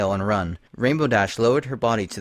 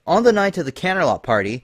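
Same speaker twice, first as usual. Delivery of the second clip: higher, faster and louder on barely knew; suspicious Twilight; 7 semitones higher, 4 dB louder than usual. Acted. Twilight pressing Lyra with suspicion, higher and faster. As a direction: build the accusation with rising alarm.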